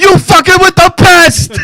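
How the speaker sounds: put-on very high shouted Cuban gangster accent; Tony Montana voice. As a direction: scream it like Scarface on the balcony. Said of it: "like Tony Montana".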